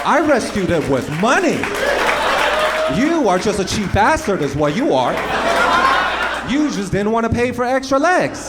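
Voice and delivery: acted loud quarrel. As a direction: argue it out with a cheap pet buyer. Acted argument with pet buyer.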